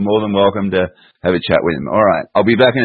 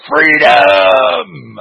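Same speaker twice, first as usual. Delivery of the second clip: long, high, loud drawn-out shout; Braveheart battle cry; 7 semitones higher, 5 dB louder than usual. Acted. Host acting out his favourite Braveheart bit, hammed-up shout. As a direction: bellow it like a film hero's dying cry. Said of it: "he's hung, drawn and quartered. He says".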